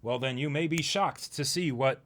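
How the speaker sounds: ordinary talk, nothing marked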